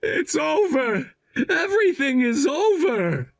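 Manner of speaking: broken, laughing through tears; despairing laugh-cry. Acted. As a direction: crack into a hopeless half-laugh, half-sob. Husband realises he is ruined.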